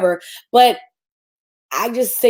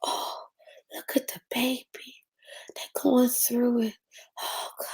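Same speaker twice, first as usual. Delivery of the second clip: hushed pitying whisper to a friend; mock pity whispered over a struggling vendor; 11 dB quieter than usual. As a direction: whisper it as you pass, pitying. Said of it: "I just walk by sometimes like".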